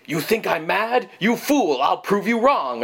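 high, ranting mad scientist voice. Retold or imitated. Cohost imitating the stock mad scientist, ranting high. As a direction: rant like a cartoon mad scientist. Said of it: "every other mad scientist I've ever seen says".

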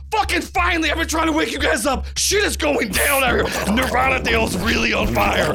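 high, fast panicked yelling; frantic shout. Acted. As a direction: yell it like everything's burning. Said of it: "and Jenkins goes".

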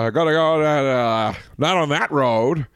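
put-on old Maine farmer warning teens; Maine accent. Shown as a demonstration. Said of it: "can you do a Maine accent?".

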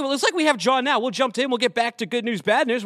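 ordinary talk

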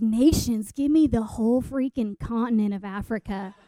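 scoff, then demand it all boldly; scoffing bravado. Retold or imitated. Zealous speaker outbids her mission leaders, low brash demand. Retold bold, scoffing prayer request.

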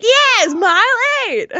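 high, slow mock Italian accent. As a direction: ham up an Italian guy's accent, pitched high. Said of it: "like, Italian accent or something".

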